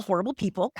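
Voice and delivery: ordinary talk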